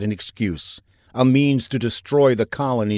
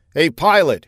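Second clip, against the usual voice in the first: shouted call, higher and louder; 6 semitones higher, 5 dB louder than usual. A narrator's reading. Safinger to the pilot behind the cockpit door, shouting. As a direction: call out loudly through a door. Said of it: "he shouted towards the door".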